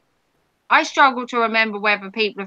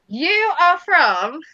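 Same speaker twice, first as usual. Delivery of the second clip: slow teasing buildup; 4 semitones higher, much slower than usual. Shown as a demonstration. Streamer teasing a viewer before naming their state, slow drawn-out build. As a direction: drag out you are from, to build suspense. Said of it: "this is good, that's my favorite".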